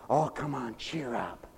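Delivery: soft coaxing; coaxing a child. Pretend. Parent softly coaxing a whimpering little boy to cheer up. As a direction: gently coax a pouty little boy. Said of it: "when your little junior comes in".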